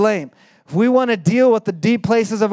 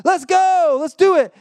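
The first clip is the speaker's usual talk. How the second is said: high, drawn-out whoop of agreement; eager Samuel; 11 semitones higher than usual. Acted. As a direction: cheer it on, all fired up. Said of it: "And Samuel's like".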